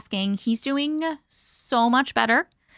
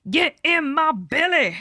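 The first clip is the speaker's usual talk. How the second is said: mock Fat Bastard; 4 semitones higher, slower than usual. Fat Bastard catchphrase, slow.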